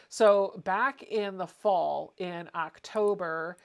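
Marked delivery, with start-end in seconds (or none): none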